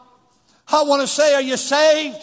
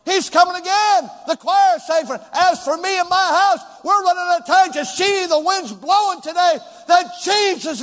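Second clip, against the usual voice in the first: loud, high, urgent preaching cry; fiery revival shout; 7 semitones higher, 6 dB louder than usual. Real, not acted. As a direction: shout the warning to the congregation.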